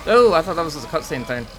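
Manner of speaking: sudden loud surprise; startled loud. Real, not acted. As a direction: caught off guard, blurting it out. Surprised by sudden game event.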